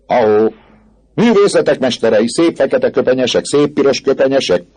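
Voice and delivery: rowdy student call; mocking hail. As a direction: holler admiringly in jest at the robes. Students hail robed masters.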